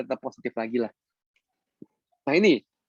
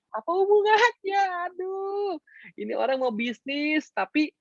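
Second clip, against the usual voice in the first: much higher, slow incredulous question; exasperated; 13 semitones higher, slower than usual. Acted. Coach baffled by a member mixing love life and business, much higher. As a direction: throw up your hands in disbelief.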